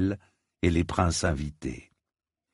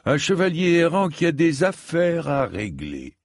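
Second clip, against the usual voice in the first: disdainful; 9 semitones higher than usual. A narrator's reading. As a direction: look down on him with contempt. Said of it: "le considéra avec dédain".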